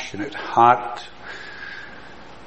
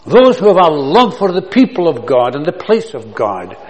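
rising, louder preaching build; preaching crescendo; 3 semitones higher, 5 dB louder than usual. Real, not acted. Preacher rallying the congregation, building loud. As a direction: drive each "those who" harder.

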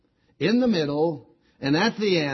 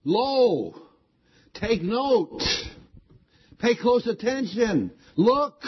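"Lo" and "look" called out higher, each phrase pressed hard; emphatic lo; 8 semitones higher, slower than usual. Shown as a demonstration. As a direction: urge the congregation to take note, pitch raised. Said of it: "this word lo is an emphatic word".

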